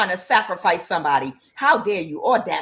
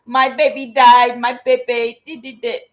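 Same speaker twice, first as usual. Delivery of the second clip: mock whining grief; 3 semitones higher, 6 dB louder than usual. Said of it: "and just be like".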